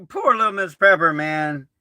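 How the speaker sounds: ordinary talk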